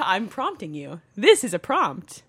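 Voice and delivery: ordinary talk, nothing marked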